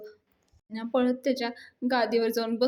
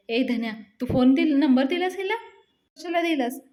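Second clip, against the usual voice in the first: acted scolding, higher and raised; yelling; 3 semitones higher, 4 dB louder than usual. Palya scolds Dhanya for giving out the number, yelling. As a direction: turn on him with a high-pitched demand. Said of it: "धन्याला ओरडायला लागतो".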